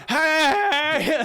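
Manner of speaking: long, high fire-breathing yell; acted scream. Character breathing fire at rats, high drawn-out scream. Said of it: "screaming fire everywhere".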